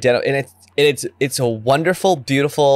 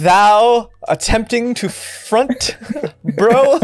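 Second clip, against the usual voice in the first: mock olde wizard; 6 semitones higher, much slower than usual. Mock archaic wizard speech.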